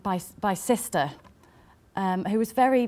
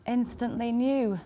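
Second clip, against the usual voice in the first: normal talking voice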